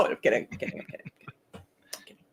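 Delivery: ordinary delivery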